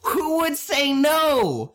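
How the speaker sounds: high, drawn-out disbelief; mock incredulity. Dismissing objections to the accent potion, mock incredulous. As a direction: stretch it into an over-the-top protest.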